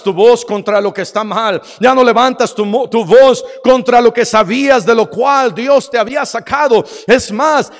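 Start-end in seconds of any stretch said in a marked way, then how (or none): none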